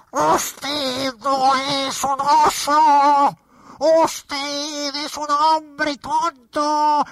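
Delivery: muy agudo y muy lento, furioso. Acted. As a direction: escupe cada palabra, lento. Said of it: "Estaba furioso". (vicepresidente furioso)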